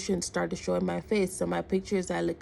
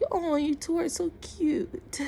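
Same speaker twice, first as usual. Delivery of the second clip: high, drawn-out cooing imitation; imitating a bartender fawning over a couple; retold gushing; 10 semitones higher, slower than usual. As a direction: coo it slowly in a high voice.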